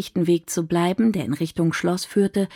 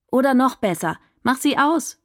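calling out; 6 semitones higher than usual. A narrator's reading. Dahlia calls to Rahim to switch it off, raised voice. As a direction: call it out to the figure behind the light. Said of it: "rief sie dem Licht entgegen".

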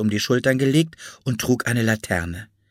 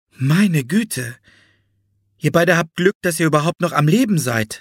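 higher-pitched woman's exclamation; lightly voiced, concerned grown-up; shocked Florence Nightingale; 6 semitones higher, 4 dB louder than usual. A narrator's reading. Florence reacts to the children's injuries, higher shocked voice.

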